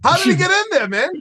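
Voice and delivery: high, loud disbelief; incredulous outburst. Real, not acted. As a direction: shout it like you can't believe it. Incredulous echo of cohost.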